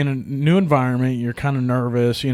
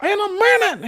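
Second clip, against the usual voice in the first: put-on very high, whiny teenage voice; teen Rome answering his father in a high whine; impression of Rome. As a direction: squeak it out like a fourteen-year-old boy. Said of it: "spot-on impression of Rome".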